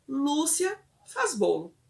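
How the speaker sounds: frase lenta, em tom mais agudo; repetição pausada. Acted. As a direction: repita devagar, como exemplo de resposta. Voices a child's incomplete repetition. (resposta da criança, lenta e mais aguda)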